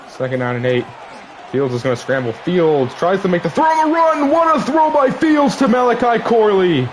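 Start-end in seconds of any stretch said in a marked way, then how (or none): 2.3-6.9 excited. Real, not acted. Great throw call.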